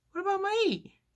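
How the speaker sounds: very high, tiny overlooked-customer voice; mock plaintive squeak. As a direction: squeak it like you've been forgotten. Ignored customer's reaction acted out.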